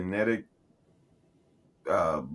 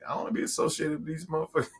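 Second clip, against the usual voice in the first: fast, higher-pitched mock annoyance; mock mad; 8 semitones higher, faster than usual. Friend objecting to being named with the show, mock mad. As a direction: play her irritated, rushed and pitched up. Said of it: "She probably be mad".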